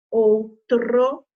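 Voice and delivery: "Outro" loud, with the tr pushed; forced R. Shown as a demonstration. Teacher helping pupils notice the sound, forced R in "Outro". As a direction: punch out the R in "Outro". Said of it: "dando essa forçadinha no R".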